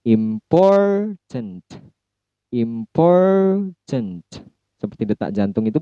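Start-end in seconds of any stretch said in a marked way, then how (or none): 0.0-4.2 Important, POR high and held long; careful demo of word stress on Important. Shown as a demonstration. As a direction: say "Important" slowly with POR lifted. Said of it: "Jadi pornya yang tinggi".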